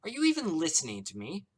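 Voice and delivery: loud, high, exasperated shout; angry shout. Acted. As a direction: demand an answer furiously. Celeste shouts.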